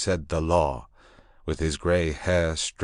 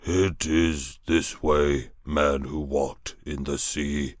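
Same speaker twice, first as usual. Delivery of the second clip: slow creature voice; 2 semitones lower, slower than usual. Acted. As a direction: speak it slowly like a beast. Grey thing guides.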